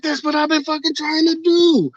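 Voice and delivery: very high strained retold outburst; exasperated yell. Snapping back at the officer asking for cooperation, exasperated. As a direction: burst out in disbelief.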